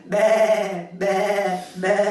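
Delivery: high, loud bleating "Be, be, be" like a lamb; sheep bleat. Acted. Speaker showing the audience their chorus line, bleating. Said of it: "tenéis que decir".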